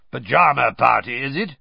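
loud growled taunt. A narrator's reading. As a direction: growl it up the stairs. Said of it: "he growled up the stairs".

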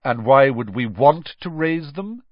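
cool, disdainful question; cold. A narrator's reading. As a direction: challenge them coldly. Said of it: "said a cold voice".

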